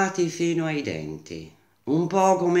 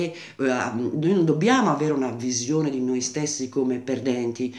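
usual speaking voice

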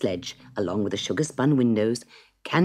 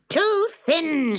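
much higher and louder, "too thin" snapped hard; witch snapping; 6 dB louder than usual. Acted. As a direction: snap "too thin" at the boy in disgust. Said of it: "she snapped".

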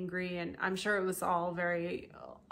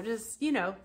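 normal talking voice

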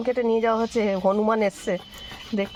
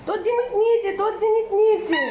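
high, teasing sing-song; 11 semitones higher than usual. Real, not acted. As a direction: tease the bird in a high voice. Teasing birds over food.

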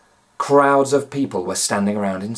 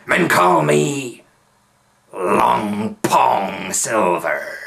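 slow, drawn-out pirate boast; pirate leader naming himself to the prisoners; menacing pirate; 4 dB louder, much slower than usual. A narrator's reading. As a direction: savour the name like a threat. Pirate introduces himself slowly.

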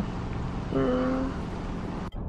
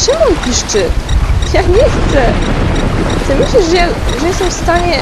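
loud, higher frightened outburst; panicked shouting; 6 semitones higher, 7 dB louder than usual. Real, not acted. Gamer panicking at the squealing device, frightened shout. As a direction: shout in fearful disbelief.

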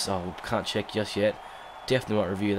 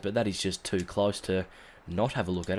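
ordinary talk, nothing marked

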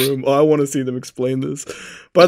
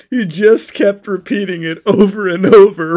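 normal talking voice

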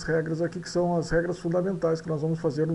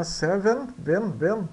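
ordinary talk

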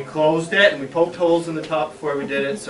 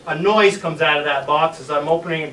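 plain reading voice

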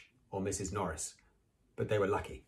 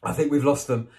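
panting; 6 semitones higher, 7 dB louder than usual. Acted. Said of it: "Harry panted".

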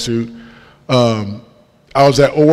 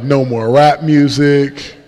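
usual speaking voice

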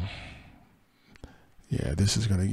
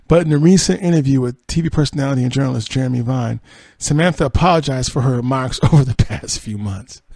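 louder, animated news-reading voice; 3 semitones higher, 7 dB louder than usual. Acted. Host reading celebrity news about Samantha's apology, news read. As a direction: read the story out to an audience.

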